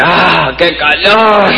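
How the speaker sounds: put-on high, slow, laughing old-lady voice; a grandma fanning herself, drawn out; grandma complaining. Grandmother arriving sweaty, complaining of the heat in a high slow voice. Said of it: "La abuela dice".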